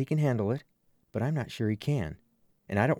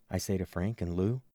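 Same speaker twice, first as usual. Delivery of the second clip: usual speaking voice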